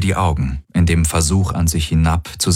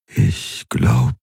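hoarse, barely more than a whisper; raspy whisper. A narrator's reading. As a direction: push it out on breath alone, slowly. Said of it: "kaum mehr als ein Flüstern". (kaum mehr als ein raues Flüstern)

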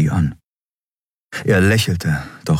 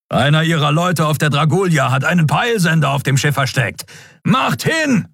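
urgent dragon voice; 9 semitones higher than usual. Acted. Kero explains, urges them on.

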